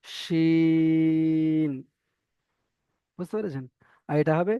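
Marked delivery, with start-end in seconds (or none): 0.0-1.7 শিন with a pressed sh and a long drawn vowel; pressed sh shin. Shown as a demonstration. Teacher contrasting letters, pressed drawn শিন. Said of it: "এই উচ্চারণটা এখানে হবে".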